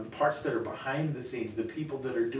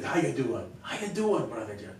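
put-on Dan's accent. Church volunteer asking after his pastor, imitated accent. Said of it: "he had an accent like this".

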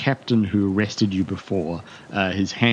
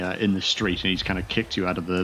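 ordinary talk, nothing marked

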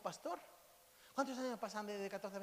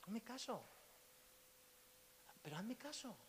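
softer, confiding plea; soft appeal; 10 dB quieter than usual. Real, not acted. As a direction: lean in and ask them to trust you. Intimate appeal to listener.

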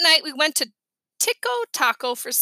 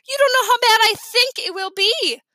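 put-on high, louder protest from a dreading kid; exasperated protest; 9 semitones higher, 6 dB louder than usual. A narrator's reading. Kid protesting to Mom about the summer, exasperated. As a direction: argue back loudly in a raised voice. Boy protesting to his mother.

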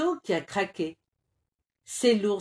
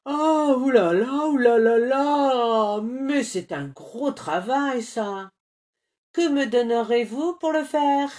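bear voice; 5 semitones higher, 6 dB louder than usual. A narrator's reading. Bear weighing a big job.

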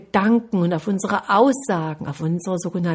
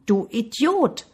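slow, higher-pitched echoed insult; 4 semitones higher, slower than usual. A narrator's reading. Mountain returns the boy's insult, slow echo. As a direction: draw it out like an answering echo. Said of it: "Die Stimme ließ nicht lange auf sich warten".

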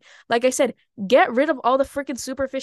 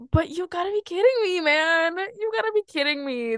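higher, incredulous outburst; disbelief; 6 semitones higher than usual. Real, not acted. Reacting to her own story, higher incredulous disbelief. Outraged at bare minimum treatment.

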